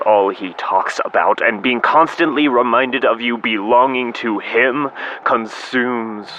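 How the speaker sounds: declaimed, tormented letter; bitter confession. Acted. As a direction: build to an anguished accusation. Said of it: "confess my bitter feelings".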